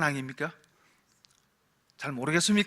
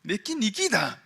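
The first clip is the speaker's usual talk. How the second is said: slow Gyeongsang dialect line, first soft then back up; 5 semitones lower, 7 dB quieter, slower than usual. Retold or imitated. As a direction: say it like a Gyeongsang father. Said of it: "경상도 말로 그래서 이렇게 이야기합니다". (느린 경상도 사투리로)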